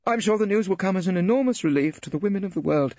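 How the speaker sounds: cool sarcasm from a female barrister; dry lady barrister. Acted.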